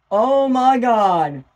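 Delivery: slow, drawn-out amazement; stunned disbelief. Real, not acted. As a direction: let it stretch and fall, awestruck. Stunned after the touchdown.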